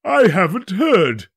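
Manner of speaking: slow, loud, drawn-out yawn; bored merchant mid-yawn. A narrator's reading. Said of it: "yawning again".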